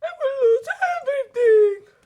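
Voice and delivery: very high, loud despairing cry; horrified wail. Acted. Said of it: "dropped in horror".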